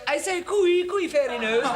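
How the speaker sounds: high, loud hammed-up toff call; mock posh cooey. [name] faking posh, calling the barman. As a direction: sing out cooey like a silly aristocrat.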